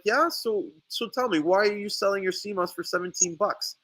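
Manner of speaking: high-pitched retold challenge; pointed challenge. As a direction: press the rival for an answer, pitched well up. Retold pointed question to the rival.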